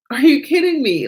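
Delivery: excited disbelief. Real, not acted. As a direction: repeat it with rising amazement.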